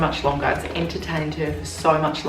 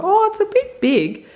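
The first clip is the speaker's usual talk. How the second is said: mild surprise; 4 dB louder than usual. Retold or imitated. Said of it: "I thought".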